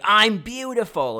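mock screech of a bitter former queen bee; ugly shrill scream. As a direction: scream I'm beautiful slowly and ugly, like a hurt woman. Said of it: "in the most ugly way possible".

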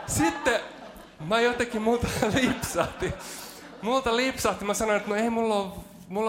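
preacher breaking into laughter mid-story; real laughter. Real, not acted. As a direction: crack up while confessing the slip. Bursts out laughing telling story.

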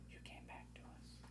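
eerie breathy whisper. Acted. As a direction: whisper it into his ear. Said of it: "It was a whisper, Alex".

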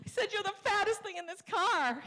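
very high, mock tearful wail; bawling wife. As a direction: imitate wife confessing through sobs. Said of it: "And she's bawling".

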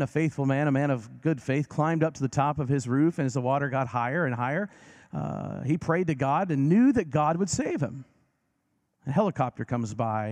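ordinary talk

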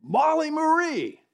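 "Molly Marie" slow and high, parent ticked off; stern parent full name. Shown as a demonstration. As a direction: call the child's full name like a warning. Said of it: "When I was ticked off at her".

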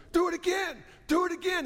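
high, strained coach's yell, imitated; hoarse coach yelling. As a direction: mimic an old-school coach screaming in a hoarse voice. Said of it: "He had this hoarse voice".

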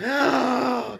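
high, loud voiced gasp; big overdone intake of shock. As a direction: gasp dramatically at the name. Reacts to Will Poulter's name.

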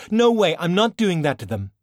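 loud, high no; firm refusal. Acted. Valkyrie rejects faking her death, loud no. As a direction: reject it outright.

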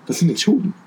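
mock bewilderment. Puzzled pupil in the boat hearing sailing jargon, acted bafflement. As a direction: play the clueless beginner. Confused pupils answering the yelled order.

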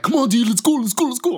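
high, loud, hurried urging; rushing friend along. Retold or imitated. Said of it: "I'm, like, being all fast".

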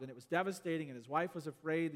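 usual speaking voice

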